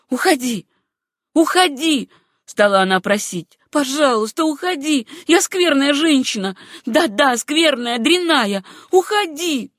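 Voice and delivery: loud, high, pleading hysteria; a self-loathing hysterical plea. A narrator's reading. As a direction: beg her to go, near breaking. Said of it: "были произнесены истерическим голосом".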